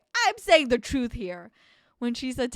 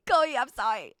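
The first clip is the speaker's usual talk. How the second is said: ordinary delivery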